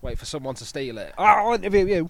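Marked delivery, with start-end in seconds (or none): 1.2-2.1 high, loud mocking put-on voice. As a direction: squeal it out in a silly mock character voice. Mocking a convict's voice.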